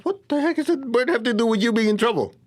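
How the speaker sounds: high, exasperated parent; baffled mom. Retold or imitated. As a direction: sound thrown off by the kid's distraction. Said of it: "you're going".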